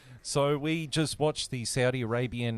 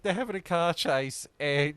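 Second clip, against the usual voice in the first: ordinary talk, nothing marked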